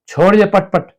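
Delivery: high, loud call to stop; shouting. Acted. Wife shouting at the child from afar, loud. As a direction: shout from the other room.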